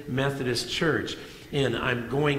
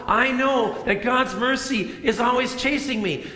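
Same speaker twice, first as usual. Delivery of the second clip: mild proclaiming yell; 8 semitones higher than usual. Retold or imitated. David crying out his faith in God's mercy, lightly raised. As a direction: lift it like a cry of faith. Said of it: "And he yells out".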